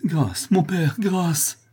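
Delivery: very high, pleading cry; frightened, imploring wail; crying for mercy. A narrator's reading. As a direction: beg desperately to be spared. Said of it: "s'écria-t-elle".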